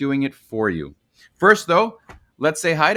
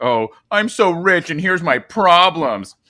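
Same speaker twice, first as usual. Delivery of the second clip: mocking rich whiner; 3 semitones higher, 4 dB louder, slower than usual. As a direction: mimic a pampered royal moaning about problems. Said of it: "why does anyone care?".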